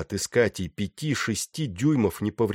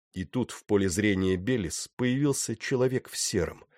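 ordinary delivery, nothing marked

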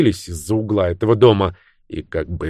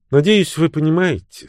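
higher-pitched, faintly hesitant opening; slightly unsure; 9 semitones higher than usual. A narrator's reading. Bridget breaks an awkward silence, slightly unsure. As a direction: begin high, with a touch of uncertainty. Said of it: "с лёгкой неуверенностью произнесла она".

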